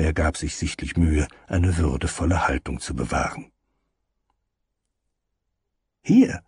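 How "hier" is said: very high squeaky cook. Acted. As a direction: pipe it in a tiny high voice. Head cook voiced.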